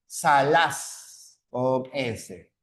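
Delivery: salaz said very slowly, stressing the S; slow careful pronunciation. Shown as a demonstration. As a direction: stretch out salaz so the S is heard. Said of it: "Por ejemplo". (salaz muy despacio, marcando la S)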